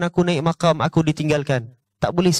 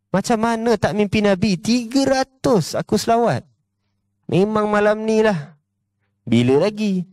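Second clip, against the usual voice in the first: mock smug; 4 semitones higher than usual. Mocking overconfidence.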